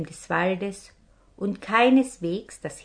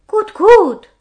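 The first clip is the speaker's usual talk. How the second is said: much higher, louder piping little-man voice; tiny little voice; 14 semitones higher, 16 dB louder than usual. Acted. Little robber captain answers his men, in a high tiny voice. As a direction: squeak it out like a tiny man in a big bed. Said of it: "säuselte ein Stimmchen".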